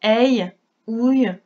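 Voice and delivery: aïe, ouille drawn out slowly, each sound held; sounds demonstrated. Shown as a demonstration. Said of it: "qui était le son aïe".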